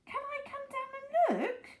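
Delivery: very high, strained calling voice; eager shout. A narrator's reading. Mister Hoppy asking to come down, shouting. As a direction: call down high, without much volume. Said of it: "Mister Hoppy shouted".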